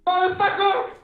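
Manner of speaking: high, rough, snapping comic voice; Richard Pryor voice. Retold or imitated. Streamer voicing Syshet as a grumpy comic. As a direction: bark it like an old stand-up. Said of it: "you sound like Richard Pryor".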